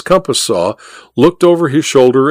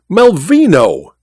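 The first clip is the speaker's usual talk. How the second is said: drawn-out, higher cry of the name; astonished cry; 5 semitones higher, slower than usual. A narrator's reading. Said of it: "cried he".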